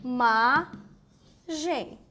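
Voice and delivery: imagem very slowly as i, ma, gem; syllable-by-syllable split. Shown as a demonstration. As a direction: separate imagem into three syllables with long gaps. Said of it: "O i sozinho forma uma sílaba".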